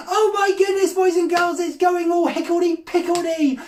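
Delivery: high-pitched comic fretting; mock dismay. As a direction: fret theatrically to a young audience. Wand falls apart, mock dismay.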